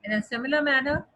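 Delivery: ordinary talk, nothing marked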